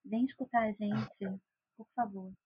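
low, soft sultry purr; femme fatale. Shown as a demonstration. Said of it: "vou fazer uma voz sensual agora".

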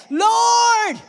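high, loud cry for help; desperate cry. Retold or imitated. Peter sinking in the water, crying out a high loud plea. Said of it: "what's the first thing he said".

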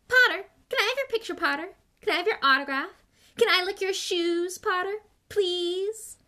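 high, loud, eager mocking imitation; Colin. A narrator's reading. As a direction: gush like a pestering fan, cruelly exaggerated. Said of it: "cruel but accurate impression of Colin".

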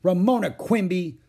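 loud, high reproach by full name; stern parental reprimand; scolding mother. Acted. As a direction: call the culprit out sternly. Mother scolds naughty child.

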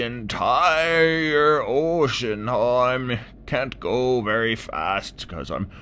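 put-on high, slow, creaky old complainer; old tortoise voice. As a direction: groan it out like an aching old tortoise.

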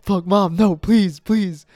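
loud, high retold begging; freaking out. Child begs mom to stop the ritual, panicked. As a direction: beg mom desperately, voice pushed up and loud. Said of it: "like, freaking out".

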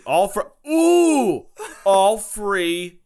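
loud, high, whooping chant; excited cry. Pretend. Echoing slogan with wild cry.

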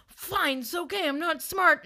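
high-pitched mock huff; puffy, wounded retort. Insulted date conceding to her, huffy. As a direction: give in with exaggerated hurt pride. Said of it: "he got really kind of puffy".

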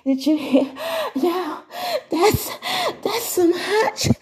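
high, giggly mock fan voice; excited fan voice. Fans react to her hot content, high and giggly. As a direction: squeal it like a thrilled fan. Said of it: "That's what they be saying".

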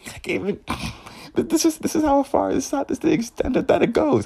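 squealing high through laughter, rushed; can't get the words out for laughing; laughing disbelief. Real, not acted. Telling viewers about the catfish, laughing in high disbelief. Cracking up at the absurdity.

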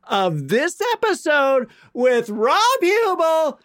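high, slow showbiz announcer. Acted. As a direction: stretch out the host's name. Hammed-up segment announcement.

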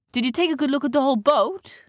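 high-pitched pirate character voice; inquiring pirate. A narrator's reading. One pirate asks the other about the stolen boat, high and inquiring.

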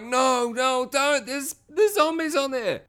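high-pitched imitation of frantic pleading; mock panicked warning. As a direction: plead high as if calling someone back. Warns man jumping into the water.

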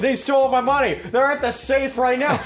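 frantic shouting. Acted. Acting out frantic heist call.

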